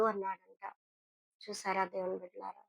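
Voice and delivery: usual speaking voice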